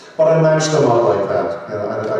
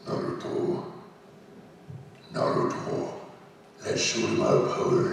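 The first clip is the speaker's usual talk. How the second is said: Kurama as an Irishman; 11 dB quieter than usual. Acted. Voice actor playing a fun mash-up for fans, Irish Kurama. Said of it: "Let's make a Kurama, uh, an Irishman".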